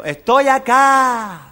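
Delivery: cave echo. Acted. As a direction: drag it out like an echo. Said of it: "Y el eco".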